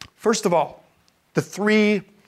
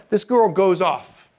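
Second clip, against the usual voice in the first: ordinary talk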